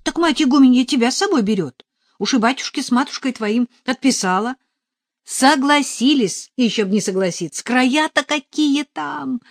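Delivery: put-on high, loud, gushing girl's voice; chattering novice, stretching words in delight; excited young novice. A narrator's reading. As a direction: exclaim it with eager wonder. Надежа excitedly explains.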